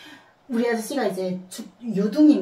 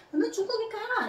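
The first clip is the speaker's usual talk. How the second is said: retold scolding shout; 14 semitones higher than usual. Mother yelling at her son to go back, imitating. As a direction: fling it at the child in a mock yell. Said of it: "엄마가".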